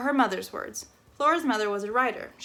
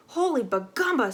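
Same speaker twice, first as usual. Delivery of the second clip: exclaimed; 4 semitones higher, 3 dB louder than usual. Acted. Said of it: "said Flora".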